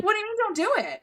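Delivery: pitched well up in disbelief; retorting in mock disbelief; incredulous. Arguing with her friend, incredulous high retort. Retold argument, incredulous reply.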